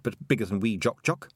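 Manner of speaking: ordinary delivery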